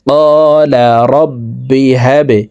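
the ব in রব্বি struck with a downward bounce, slowly; qalqala bounce. Shown as a demonstration. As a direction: push the ব down with a qalqala echo. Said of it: "নিচের দিকে ধাবিত করে ধাক্কা দিয়ে". (কলকলা দিয়ে ধাক্কা)

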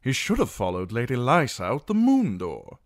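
loud, high-pitched outrage; indignant declaration. A narrator's reading. Knight condemns the singer after he is gone, indignant. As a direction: declare the sentence with heated force. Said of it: "Sir Marwyn Belmore declared".

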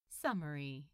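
plain reading voice

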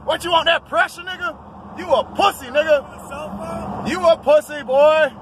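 high, loud goading yell; taunting shout. Real, not acted. Daring the other driver to race, shouting high. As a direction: scream the dare across the lanes.

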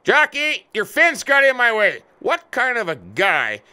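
angry yell. Real, not acted. Trucker hitting the neighbour's fence, yelling in frustration. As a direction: yell up at him, fed up.

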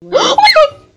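high shriek breaking into laughter; scared laughing scream. Real, not acted. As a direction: scream then laugh at the fright.

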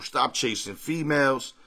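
usual speaking voice

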